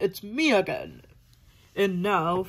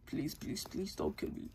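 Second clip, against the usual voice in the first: much softer, whispered pleading; whispered begging; 5 semitones lower, 15 dB quieter than usual. Acted. Eggman begging Sonic for mercy in a soft whisper. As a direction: beg in a frightened whisper. Villain begs for life.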